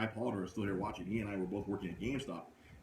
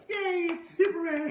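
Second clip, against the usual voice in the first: high, loud, slow cartoon cheer; excited Robin; 8 dB louder, slower than usual. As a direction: gush like a starstruck kid sidekick. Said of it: "Robin is like".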